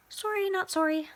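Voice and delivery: high sassy quip; sassy sorry not sorry. Real, not acted. Vlogger sassily brushing off caramel mispronouncers. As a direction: toss it off cheekily.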